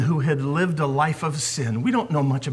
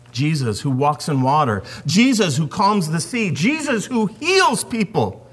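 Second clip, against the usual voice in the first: ordinary talk, nothing marked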